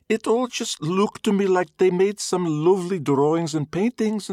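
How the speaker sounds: high, loud Glaswegian mother; Glaswegian mum voice. Acted. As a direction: play a sniffy Glaswegian mum. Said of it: "chattering in thick Glaswegian accents".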